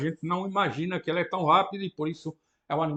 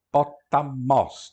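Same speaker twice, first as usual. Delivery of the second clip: "potamos" in slow separate syllables, ending stressed; final-syllable stress; 5 dB louder, much slower than usual. Shown as a demonstration. Teacher repeating Greek word, "potamos" slow and end-stressed. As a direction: break up "potamos", louder, weight on "mos". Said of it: "na última sílaba".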